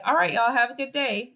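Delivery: upbeat goodbye with energy; cheerful. Shown as a demonstration. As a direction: say it like you mean it, cheerful. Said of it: "So I could say".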